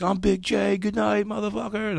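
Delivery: cocky showman closing his set; triumphant sign-off. Retold or imitated. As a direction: bellow it like a champion leaving the stage. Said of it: "I'd usually be like".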